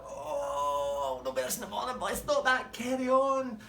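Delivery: high, loud mock cry; hammed-up whine. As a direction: whinge theatrically while loving it. Boss voiced, loving it.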